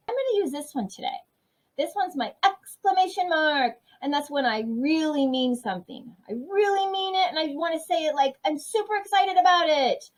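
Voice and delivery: high, bright, excited explaining; excited teacher. Real, not acted. Teacher excitedly showing kids the exclamation mark. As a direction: share the thrill of a new mark.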